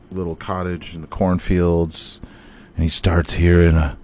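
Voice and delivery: ordinary delivery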